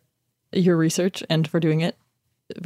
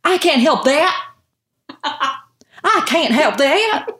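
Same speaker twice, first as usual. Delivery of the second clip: mock thick Southern drawl, pitched higher and slowing down; thick Southern accent; 7 semitones higher, 4 dB louder, slower than usual. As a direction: lay the Southern accent on thick like a child actor. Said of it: "Everybody else's accent is pretty".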